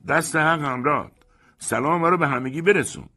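high, loud gloating call; victorious mocking yell; triumphant shout. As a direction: crow it after him down the stairs. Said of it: "پیروزمندانه بانگ زد".

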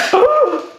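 loud, high mock-horror gasp; dramatic gasp. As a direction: gasp theatrically at nothing. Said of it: "oh, I'm being dramatic".

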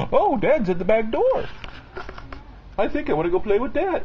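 high, quick voice for the dog; voicing the dog. Dad voicing the dogs' expected reply at the back door. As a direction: speak the dogs' line for them, eager. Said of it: "And you're supposed to say".

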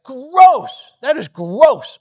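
loud, high cry of disgust; a sudden loud yuck; disgusted outburst. Retold or imitated. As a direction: blurt out revulsion at the sight. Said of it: "his first rea-, like".

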